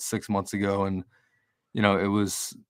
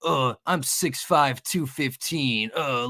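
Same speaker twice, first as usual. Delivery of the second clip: imitating a blustering streamer; 4 semitones higher than usual. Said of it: "I saw something where he's like".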